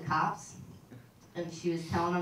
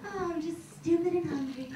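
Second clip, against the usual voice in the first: put-on childish self-pity; 8 semitones higher than usual.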